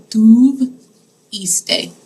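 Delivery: drawn out slowly, syllable by syllable; teacher modelling tuviste very slowly; slow model word. Shown as a demonstration. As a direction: spell it out with your voice: tu-vis-te, slowly. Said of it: "I put iste on the end of it".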